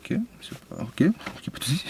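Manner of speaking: whispered, backing down; meek mock whisper. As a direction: shrink away and whisper it. His meek reply to rude examiner.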